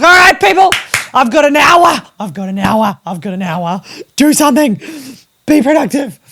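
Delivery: loud, high self pep talk; rally yourself before the hour runs out; hyped-up rallying cry. Retold or imitated. Mum shouting at herself to get working during the nap.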